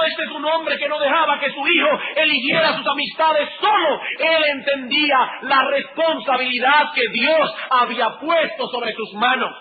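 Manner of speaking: high, loud preaching at full force; impassioned sermon climb; fiery preaching. Acted. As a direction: drive it home from the pulpit.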